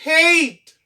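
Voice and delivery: "Hate" with A said as "ay"; long A. Shown as a demonstration. Teacher shows how added E changes sound, long A. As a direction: punch "Hate" high and falling, long A. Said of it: "son an chanje an A".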